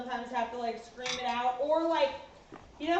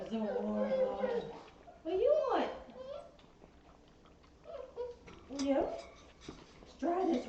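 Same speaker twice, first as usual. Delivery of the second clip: puppy talk; 4 dB quieter than usual. Real, not acted. Owner playfully cooing at her biting puppies, sing-song. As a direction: coo and tease the nipping puppies.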